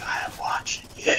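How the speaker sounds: eerie threatening whisper. Retold or imitated. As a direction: breathe it like a quiet threat. Menacing stare at pet-store turtle.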